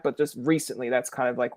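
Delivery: ordinary talk, nothing marked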